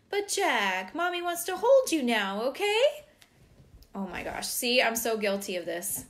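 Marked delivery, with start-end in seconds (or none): 0.1-3.0 high, loud, hammed-up pleading mom; manipulative mommy. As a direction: coax your child with exaggerated neediness, pitched way up. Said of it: "a manipulative countermove such as".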